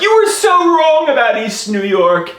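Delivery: high-pitched gushing enthusiasm. Retold or imitated. Locals praising their neighborhood, high gushing. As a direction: rave about it in a high voice.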